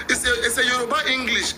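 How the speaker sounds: laughing as you explain; amused. Real, not acted. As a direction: talk through your laughter. Laughing at his own slip.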